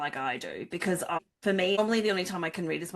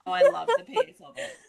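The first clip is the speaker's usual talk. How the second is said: said through laughter, high; laughing; 5 dB louder than usual. Real, not acted. Friend answering through laughter, high and laughing. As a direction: still laughing while she answers.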